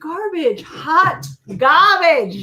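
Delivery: disgusted verdict. Real, not acted. Mocking the beer.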